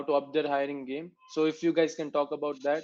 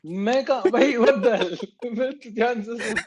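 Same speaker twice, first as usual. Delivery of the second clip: laughing protest; 7 semitones higher, 7 dB louder, much faster than usual. Real, not acted.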